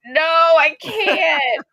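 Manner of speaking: squealing laughing no; laughing refusal. Real, not acted. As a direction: refuse through laughter, voice cracking high. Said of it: "refusing the drawl request".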